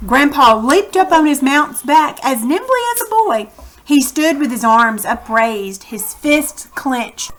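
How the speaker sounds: reading a chapter aloud to the class; teacher narrating a children's novel; story narration. A narrator's reading. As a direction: tell the story to the kids, steady and clear.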